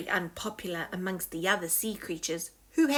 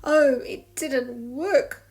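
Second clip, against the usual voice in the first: miserable; 4 semitones higher, 7 dB louder, slower than usual. Acted. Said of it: "said Sneezy miserably".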